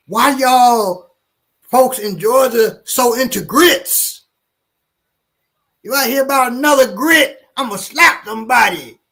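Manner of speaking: high, loud mock rant about grits; bus brother's rant. Man on bus ranting about Georgia grits, hammed-up high voice. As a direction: play the fired-up Chicago guy ranting. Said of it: "he's like".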